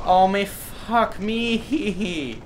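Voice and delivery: high, drawn-out exasperated whine. Real, not acted. Gamer beset by mosquitoes, exasperated. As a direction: groan it out long and high.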